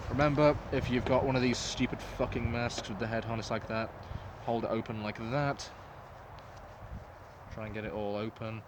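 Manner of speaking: usual speaking voice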